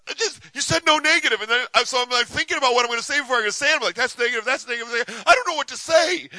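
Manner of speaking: high, loud, fast flustered rant; mock desperation. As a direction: imitate him frantic and at wits' end. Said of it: "he was almost in tears".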